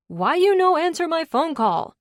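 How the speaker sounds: high, loud put-on Long Duck Dong accent; mock accent. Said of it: "What the hell is up with the accent?".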